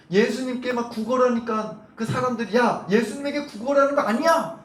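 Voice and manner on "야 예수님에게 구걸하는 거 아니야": high, mock crowd telling-off; scolding. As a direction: scold the beggar as an indignant bystander. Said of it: "그러면서 막 호통쳤을까요?". (구경꾼 흉내로 높게 호통)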